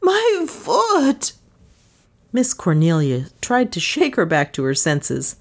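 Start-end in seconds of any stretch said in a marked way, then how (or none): 0.0-1.3 drawn-out high squeal, falling; hysterical squeal. A narrator's reading. Scalded maid dancing on one foot, hysterical squeal. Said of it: "she squealed hysterically".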